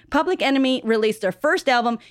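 ordinary talk

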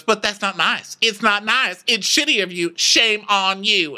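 high, hurt telling-off; mock upset scolding. [name] scolding Diana for being fake, mock upset.

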